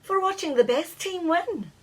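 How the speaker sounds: high, gloating call; teasing cry. A narrator's reading. Scottish fairy gloating at the humans, high call. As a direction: rub in the win, high. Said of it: "another Scottish fairy cried".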